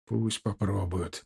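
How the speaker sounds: ordinary delivery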